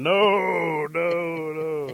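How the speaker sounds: long, loud, high wailed noes; emphatic refusal. Acted. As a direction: parents warding off a suitor. Parents warn him off their daughter.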